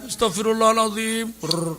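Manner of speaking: put-on tearful plea; mock weeping istighfar. Preacher shows the proper tearful repentance, mock weeping. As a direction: imitate crying while asking forgiveness. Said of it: "istighfar nangis".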